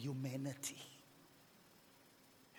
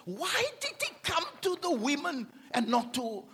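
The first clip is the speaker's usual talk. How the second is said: ordinary talk, nothing marked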